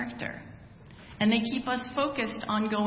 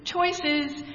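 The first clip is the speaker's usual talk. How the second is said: a knowing, sing-song "Choices" pitched higher; pointed tone; 6 semitones higher than usual. Retold or imitated. Family member's pointed reply to "I'm tired", higher and knowing. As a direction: pitch it up and let it judge. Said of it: "it would be in that tone".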